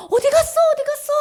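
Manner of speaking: high, loud anxious calling out; searching call. Acted. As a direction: call out like searching for a lost child. God searching for lost one.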